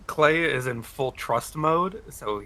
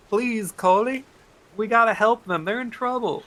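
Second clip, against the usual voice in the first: pleading; 8 semitones higher than usual. Acted. Player pleads in character.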